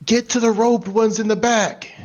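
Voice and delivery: high, loud battle command; shouted order. Acted. Gunman directing allies at robed enemies, shouted. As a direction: shout orders over the fighting.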